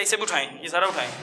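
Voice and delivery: usual speaking voice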